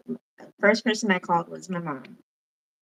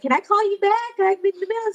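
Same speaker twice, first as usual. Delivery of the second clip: busy sister; 11 semitones higher, much faster than usual. Retold or imitated. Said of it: "and she's like".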